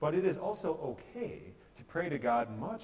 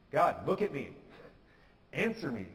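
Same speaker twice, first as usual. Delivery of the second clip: mildly aggressive, louder plea to God; bold demand; 4 dB louder than usual. Acted. David addressing God in prayer, bold and demanding. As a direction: press God boldly for an answer. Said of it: "really bold and aggressive way of speaking".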